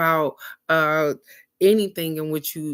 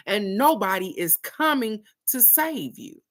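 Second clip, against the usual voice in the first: higher-pitched, emphatic retold scolding; stern cousin; 5 semitones higher than usual. Cousin to three-year-old crying on toilet, emphatic scolding. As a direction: drive it home as the lecturing cousin. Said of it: "this is her talking to a three-year-old".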